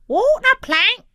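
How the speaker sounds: very high, loud parrot voice; screechy repeating parrot; parrot squawk. A narrator's reading. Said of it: "squawked the parrot".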